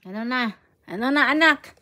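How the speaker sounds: loud, exasperated question; a mother at her wits' end. Real, not acted. Mother exasperated at baby's mess.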